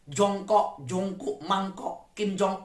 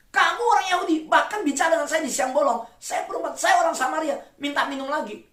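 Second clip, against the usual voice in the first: indignant woman; 8 semitones higher than usual. Acted. Samaritan woman answers Jesus.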